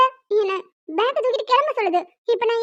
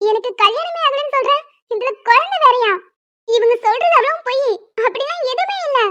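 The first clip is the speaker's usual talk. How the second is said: higher, pleading objection; exasperated protest; 4 semitones higher than usual. Acted. Young woman protesting to the elder that it's all lies. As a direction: push back in disbelief. Protesting she was never married.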